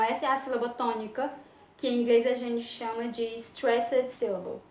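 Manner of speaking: ordinary talk, nothing marked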